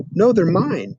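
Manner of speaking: defiant. Acted. Peter refusing the big boys, defiant. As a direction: stand your ground.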